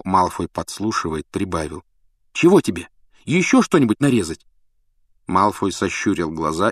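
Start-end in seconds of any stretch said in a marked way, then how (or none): 2.3-4.5 put-on annoyed boy. Ron snaps at Malfoy.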